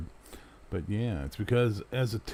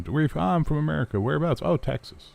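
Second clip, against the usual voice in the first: mock dialogue; 8 semitones higher, faster than usual. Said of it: "when you meet someone".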